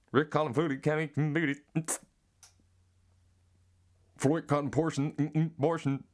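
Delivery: high put-on nonsense voice; garbled catchphrase.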